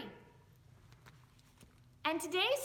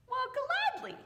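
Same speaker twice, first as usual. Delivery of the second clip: higher and louder, eager; eager man; 12 semitones higher, 5 dB louder than usual. Acted. Man agreeing to mind Foxy's sack, high eager voice. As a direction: chirp it cheerfully.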